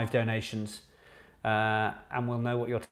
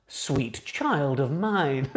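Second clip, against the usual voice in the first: slow drawn-out reveal; much slower than usual. Shown as a demonstration. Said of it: "drawn out answer".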